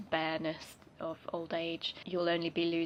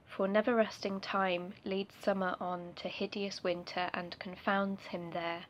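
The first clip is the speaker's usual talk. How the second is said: reciting a sonnet; 3 dB louder than usual. Acted. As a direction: recite it like a formal poem. Reads the sonnet aloud.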